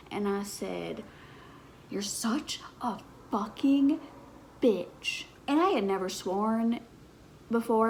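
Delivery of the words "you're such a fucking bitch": a touch of a mock snarl, higher; mildly fierce, half-performed swear; retold insult. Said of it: "the devil came out of me".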